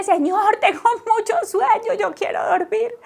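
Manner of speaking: high, pleading, near-crying prayer; exhausted mother's high tearful cry; retold tearful plea. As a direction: beg the Lord for sleep through mock tears. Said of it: "tuve que ponerme a llorar y decirle".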